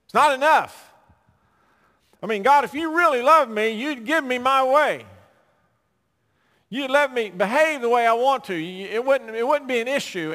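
high, loud complaining voice; demanding sinner. Retold or imitated. Mankind complaining to God, loud high whining demand. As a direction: whine at God like a spoiled child. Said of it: "and still man says".

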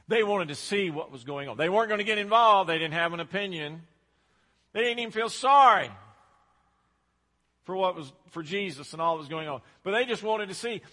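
loud, driving sermon delivery; preaching. Real, not acted. Preacher hammering the congregation, loud and rising. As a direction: build to a loud preacher's peak.

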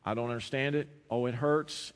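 ordinary talk, nothing marked

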